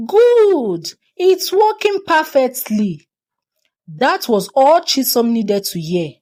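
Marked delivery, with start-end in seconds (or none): 0.1-3.1 gleeful villain. A narrator's reading. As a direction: crow with wicked delight. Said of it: "threw her head back and laughed loudly".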